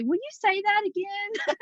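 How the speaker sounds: lightly imitated high-pitched questioning; curious foreigner's request. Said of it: "they'll say".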